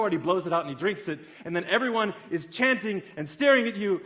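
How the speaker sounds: ordinary delivery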